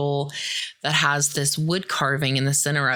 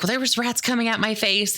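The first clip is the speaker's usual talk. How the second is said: mock bickering; 6 semitones higher, faster than usual. Acted quarrel over whose fault.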